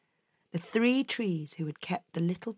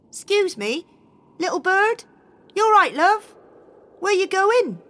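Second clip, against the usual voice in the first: high, loud kindly tree calling out; high caring tree voice; spruce tree voice; 11 semitones higher, 9 dB louder than usual. Acted. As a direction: call up gently but loudly to the bird. Spruce tree calls to bird.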